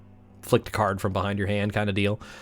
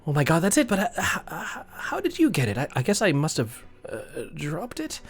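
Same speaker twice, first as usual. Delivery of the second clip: higher, halting and eager; flustered excitement; 6 semitones higher than usual. Acted. Jennifer to Jody, thrilled at the found planchette, flustered and eager. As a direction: stammer out her delight at the find. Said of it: "Jennifer looks towards you".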